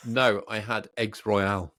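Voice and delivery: ordinary delivery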